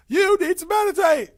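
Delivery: put-on very high, loud accented shout; comic yelling. Teacher imagines yelling at students in person, hammed-up shout. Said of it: "I'd be, like, yelling".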